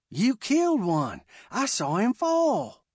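a hint of an excited boy; lightly voiced son. A narrator's reading. Son excitedly reports what he saw.

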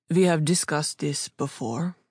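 put-on firm reply in a light German accent; firm, unwavering. A narrator's reading. Jacob closing a familiar discussion, firm and unwavering. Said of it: "his voice firm and unwavering".

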